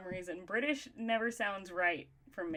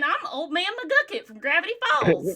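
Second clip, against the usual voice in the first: put-on crazy old southerner, high and loud; crazy old person with a southern accent; Old Man McGucket; 7 semitones higher, 9 dB louder than usual. Shown as a demonstration. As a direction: holler like a batty southern old-timer. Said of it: "you add a southern accent".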